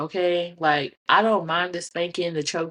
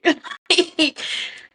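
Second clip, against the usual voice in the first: high laugh; 10 semitones higher than usual. Real, not acted. Laughing at homeboy's remark.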